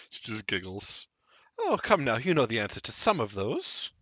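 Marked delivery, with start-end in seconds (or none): 1.6-3.9 lightly raised polite woman; Alira's voice. Acted. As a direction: suggest it with a lifted voice.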